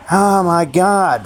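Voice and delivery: real exasperation, stretched out slowly; exasperated. Real, not acted. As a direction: let out a long, fed-up exclamation. Venting about suffering in the world.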